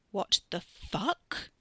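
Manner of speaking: very slow, numb disbelief; dazed. A narrator's reading. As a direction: drag it out, stunned and numb. Katie repeats, dazed.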